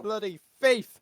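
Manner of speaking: high, loud accusing shout; angry yell. Acted. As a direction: spit out the insult. NPC yelling at the thief.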